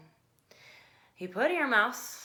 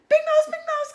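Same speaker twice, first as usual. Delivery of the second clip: very high loud little mouse call; 17 dB louder than usual. A narrator's reading. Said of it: "called Little Mouse".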